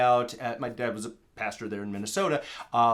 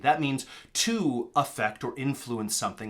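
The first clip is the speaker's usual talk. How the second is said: normal talking voice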